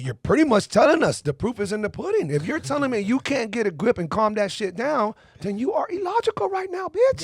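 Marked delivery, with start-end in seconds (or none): 3.5-7.2 shouting. Real, not acted. Ranting to friends about emotional women, shouting. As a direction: yell it out, pitch way up.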